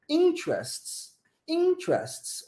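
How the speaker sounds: "interests" slowly, high rise on IN, then falling; first-syllable stress. Shown as a demonstration. Teacher showing English stress to Brazilian students, first-syllable stress on "interests". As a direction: lift the start of "interests" high and draw it out. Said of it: "Olha como é que a gente sobe no início da palavra".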